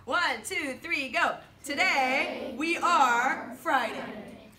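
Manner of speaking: loud, drawn-out, syllable by syllable; slow choral-reading lead. Shown as a demonstration. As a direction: lead a class reading aloud together, very slowly. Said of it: "I'll say it the French way".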